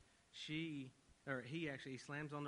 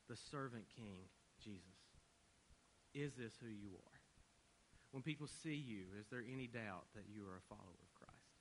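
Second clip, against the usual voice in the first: earnest sermon appeal. Acted. Preacher challenging congregation to reflect the gospel, earnest. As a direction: press the question home gently.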